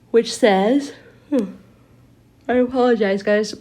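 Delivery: talking through a yawn; words stretched by a yawn. Real, not acted. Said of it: "I'm yawning a lot tonight".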